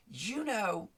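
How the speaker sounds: a hint of the grateful service rep; phone lady's voice.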